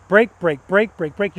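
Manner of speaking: each brake pulsed in an even rhythm; progressive brake cue. Shown as a demonstration. Instructor showing progressive braking, rhythmic pulses. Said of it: "the braking is progressive".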